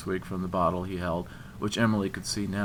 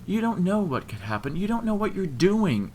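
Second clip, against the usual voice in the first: high-pitched plea, leaning hard on "what you're doing"; emphatic plea; 12 semitones higher, 5 dB louder than usual. A narrator's reading. Woman warning of unknown danger, plea stressing "what you're doing". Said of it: "emphasizing the last words in her plea".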